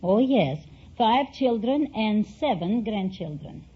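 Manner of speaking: ordinary delivery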